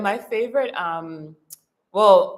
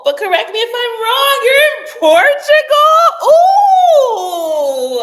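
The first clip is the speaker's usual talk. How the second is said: high, loud excited squeal of discovery; excited surprise; 13 dB louder, slower than usual. Real, not acted. Streamer finding out a viewer is in Portugal, excited squeal. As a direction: squeal it, rising to a long 'ooh'.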